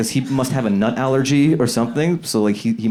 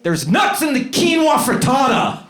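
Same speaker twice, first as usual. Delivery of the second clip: high, slow imitation yell; hammed-up high-pitched shout; yelled warning. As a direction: holler it like an alarmed diner storming out. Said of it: "he yells as he walks out".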